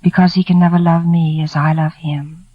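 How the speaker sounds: ordinary delivery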